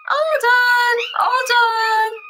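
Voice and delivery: 大声哼唱式哄小狗. Real, not acted. Sing-song over crying puppy.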